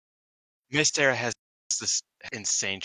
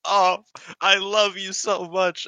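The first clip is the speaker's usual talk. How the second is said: much higher, slow, gushing coo; doting gush; 13 semitones higher, 8 dB louder, much slower than usual. Retold or imitated. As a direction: melt over her like a fan. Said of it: "And I was like".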